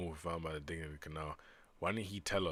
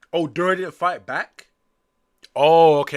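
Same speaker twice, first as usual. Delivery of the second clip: higher and louder oh; surprised realization; 10 semitones higher, 19 dB louder than usual. Real, not acted.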